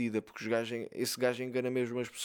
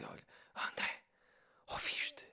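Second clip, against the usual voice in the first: hushed, barely voiced whisper; [name] a whispering; 9 dB quieter than usual. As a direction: whisper it as if woken in the night. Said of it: "ela fala baixinho". (sussurrar baixinho)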